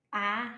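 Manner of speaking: broad, loud Boston "ah"; Bostonian vowel. Shown as a demonstration. Student showing how their A's change, Bostonian "ah". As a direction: sound "ah" the thick Bostonian way. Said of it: "dropping my R's and a lot of my, uh, A's becomes like".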